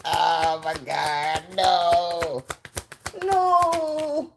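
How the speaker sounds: loud, drawn-out disbelieving Kevin Hart impression. Reacting to a tarot card in a loud Kevin Hart voice. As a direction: stretch out the shock like Kevin Hart. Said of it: "In my Kevin Hart voice".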